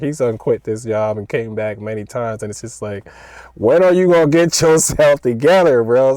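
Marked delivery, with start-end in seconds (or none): 3.5-6.2 teasing coworker. Retold or imitated. Busting on coworker E.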